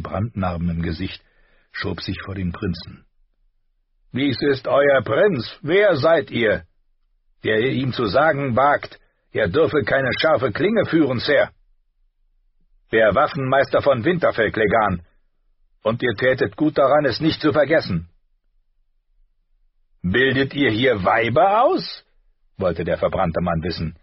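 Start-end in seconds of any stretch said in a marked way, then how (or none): 4.1-11.5 loud, raised challenge; forceful, higher-pitched demand; menacing knight. Acted. Knight challenges master-at-arms. 12.9-18.1 loud, raised, firm retort; forceful voice of authority; indignant master-at-arms. Acted. Master-at-arms answers back firmly. 20.0-22.0 loud, raised scornful question; knight's forceful taunt; contemptuous jeer. Acted. Knight jeers at master-at-arms.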